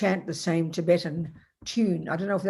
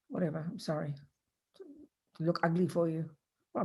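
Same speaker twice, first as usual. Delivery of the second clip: normal talking voice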